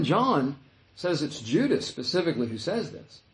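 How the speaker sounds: ordinary talk, nothing marked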